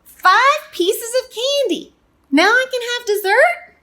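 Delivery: put-on very high, loud little kid voice; eager child. Little Pea done with candy, begging dessert, high and eager. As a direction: burst out high and loud, asking for the reward.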